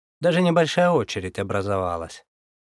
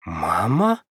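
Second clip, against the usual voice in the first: astonished; 4 semitones lower than usual. Acted. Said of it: "Также изумился я".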